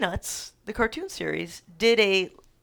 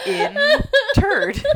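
laughing; 9 dB louder than usual. Real, not acted. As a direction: repeat the word through high laughter. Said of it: "exactly how I want to be interred".

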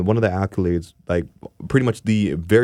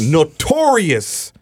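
hyped; 7 semitones higher, 4 dB louder, much slower than usual. Real, not acted. Hyping the song title.

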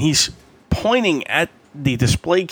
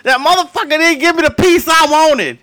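higher, louder mock rant; mock angry complaint; 12 semitones higher, 4 dB louder than usual. Irate customer grumbling about his chicken, mock anger.

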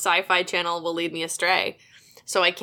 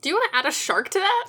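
much higher, fast drive-thru chirp; upselling cashier; 11 semitones higher, faster than usual. Pretend. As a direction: upsell it brightly and quickly. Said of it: "mock fast-food upsell".

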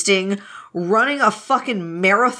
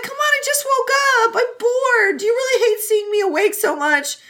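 much higher, whining complaint; whiny pleading; 13 semitones higher than usual. Retold or imitated. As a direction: beg like a bored, sulky kid. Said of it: "Wei Wuxian's like".

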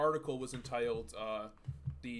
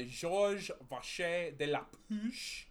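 French pronunciation; 5 semitones higher, slower than usual. Shown as a demonstration. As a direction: give "Georges Vacher de la Puche" full French vowels and a slow French lilt. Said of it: "he sounds like a real fucking frog".

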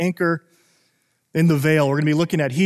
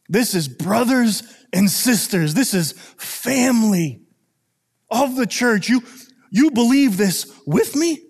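slow, higher, earnest appeal to the congregation; fervent preaching; 3 semitones higher, slower than usual. Real, not acted. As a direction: preach it like family is at stake. Pastor urges church as family.

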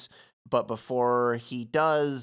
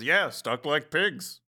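slow, higher, put-on piggy bank voice; Ham voice; 4 semitones higher, slower than usual. Recapper proudly tries a Ham voice for the piggy bank. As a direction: drawl it out like Ham the pig. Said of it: "I did a Ham voice".

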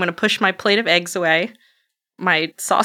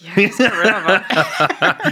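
slow laughing quip; much slower than usual. Acted. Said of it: "Sister Joan Marie's like".

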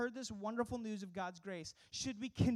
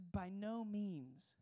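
drawn-out, solemn "By no means."; slow solemn refusal; 2 semitones lower, 3 dB quieter, much slower than usual. Retold or imitated. Pastor reading Paul's answer to the congregation, slow and solemn. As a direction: stretch "By no means." very slowly, each word weighty. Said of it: "He says".

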